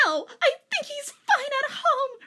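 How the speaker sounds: very high, laughing snap; giggly jealous snap; snapped. Acted. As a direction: squeal it through laughter. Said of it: "Reichuru snapped".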